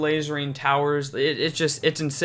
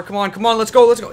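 loud, rising urgent cheer; hurrying someone; 5 semitones higher, 7 dB louder, faster than usual. Real, not acted. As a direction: urge them on faster and faster. Urging troops to reach tower.

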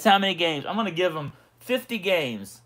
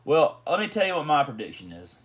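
usual speaking voice

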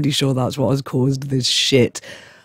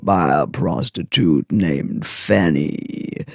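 raspy cold voice; 5 semitones lower, slower than usual. Real, not acted. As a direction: croak it out like a chain smoker. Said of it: "I literally sound like a fucking seven hundred-year-old chain smoker".

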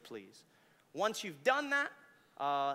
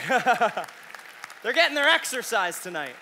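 loud joke; 5 semitones higher, 15 dB louder, slower than usual. Real, not acted. Joking about running mic carriers.

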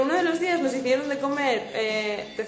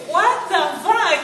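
laughing disbelief; 4 semitones higher, 8 dB louder, slower than usual. Real, not acted. Reacting to chat comment, laughing.

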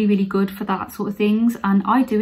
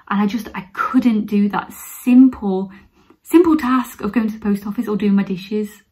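ordinary talk

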